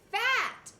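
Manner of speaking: ordinary talk, nothing marked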